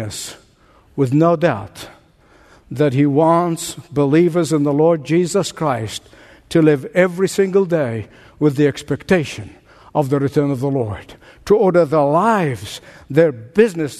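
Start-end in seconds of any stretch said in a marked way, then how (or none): none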